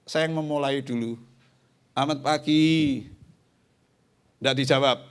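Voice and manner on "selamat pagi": friendly greeting. Retold or imitated. Lecturer greeting student.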